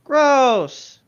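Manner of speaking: a high, loud "gross" in disgust. Real, not acted. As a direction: recoil at the thought out loud. Disgusted reaction to folklore.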